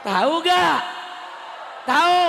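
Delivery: loud shouted question to a crowd; calling out. Real, not acted. Preacher checks whether the crowd knows, calling out.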